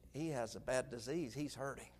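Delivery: low, very soft retold confidence; hushed explanation. Preacher friend explaining the illness, hushed and grave. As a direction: explain it quietly, with care.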